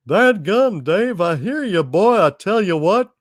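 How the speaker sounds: lightly voiced Texan salesman; Texan propane salesman Hal. Hank Hill style Hal voice.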